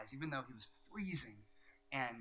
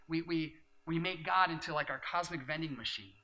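normal talking voice

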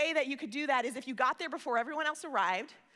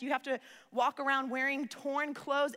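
ordinary talk

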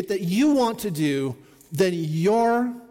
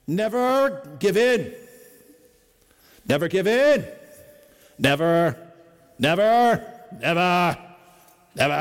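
slow, louder, stirring wartime-leader voice, dragging out each never; Winston Churchill voice; 4 dB louder than usual. Retold or imitated. Pastor reads a Churchill speech to his congregation, in a Churchill voice. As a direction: growl out each never like a defiant old statesman. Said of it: "I'll try to read it in my best Winston Churchill voice".

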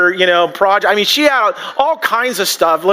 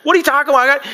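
mock dramatic lament, higher and quick; hammed-up despair, pitched up; overdramatic widow; 6 semitones higher, faster than usual. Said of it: "that's just kinda over dramatic".

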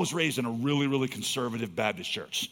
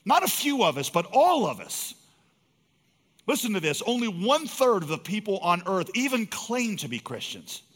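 higher and louder, driving the point home to the congregation; preaching at full force; 6 semitones higher, 5 dB louder than usual. Acted. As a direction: build to a fervent pulpit cry, slowing on key phrases. Preaching climax.

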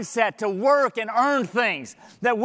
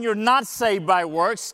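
normal talking voice

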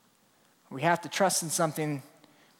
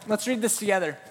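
ordinary delivery

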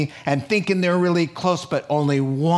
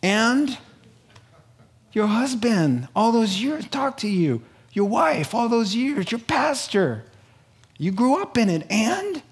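patient judge in a high-pitched voice; measured, raised voice prompting then pronouncing; Jesus; 6 semitones higher than usual. Acted. Jesus answering excuse-makers on judgment day, patient but unyielding. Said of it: "Jesus will be patient".

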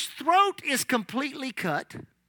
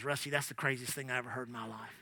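whispered aside; 12 semitones lower, 16 dB quieter, faster than usual. Retold or imitated. Sister whispers in his ear.

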